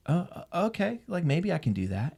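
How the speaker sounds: high, hesitant little voice; tentative inner voice. Retold or imitated. Selfish part of him considers the scheme, tentative. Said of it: "a part of me that was like".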